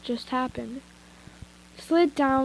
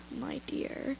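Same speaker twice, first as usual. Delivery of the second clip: villain whisper; 12 dB quieter than usual. Acted. Villain Anti introduces himself.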